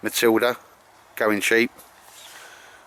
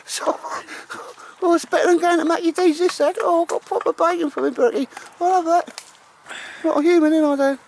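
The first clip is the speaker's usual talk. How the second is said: dog's voice. Pretend. Owner voicing his dog Baldrick to Al, high squeaky dog voice. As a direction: speak for the dog in a squeaky falsetto. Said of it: "He says".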